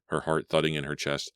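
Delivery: plain reading voice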